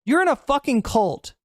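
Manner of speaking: angry. Real, not acted. Angry rant at fans.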